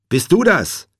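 loud, high urgent question; anxious calling. A narrator's reading. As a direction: call out to check who's there. Anxious caller on phone.

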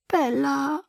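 sorrowful little voice; sad pleading. A narrator's reading. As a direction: call the name sadly, high and small. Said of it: "sagte sie traurig".

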